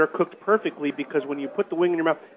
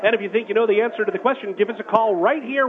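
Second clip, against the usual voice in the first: radio announcer; 4 semitones higher than usual. Acted. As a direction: pitch the contest to listeners. Radio contest call to action.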